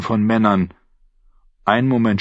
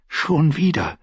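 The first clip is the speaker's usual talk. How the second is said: higher, dismayed thought; inner thought; 7 semitones higher than usual. A narrator's reading. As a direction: think it with alarm. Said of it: "dachte Arya".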